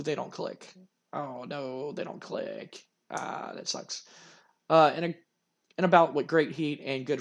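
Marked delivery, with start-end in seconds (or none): 0.0-1.9 softer, deflated sigh of a line; disappointed. Real, not acted. Disappointed at game result. 1.9-4.0 under the breath, whispered; whispered disappointment. Real, not acted. Disappointed at game result.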